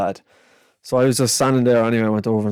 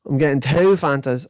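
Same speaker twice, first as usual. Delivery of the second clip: ordinary delivery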